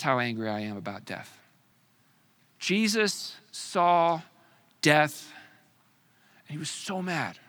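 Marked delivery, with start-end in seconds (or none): none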